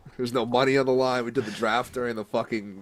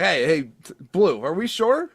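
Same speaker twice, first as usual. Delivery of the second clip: calling out to ump; 8 semitones higher, 5 dB louder than usual. Retold or imitated.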